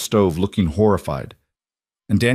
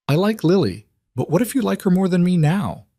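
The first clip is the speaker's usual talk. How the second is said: put-on higher child voice, anxious; worried little girl; 10 semitones higher than usual. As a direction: voice a four-year-old afraid of losing dad. Narrator voices four-year-old.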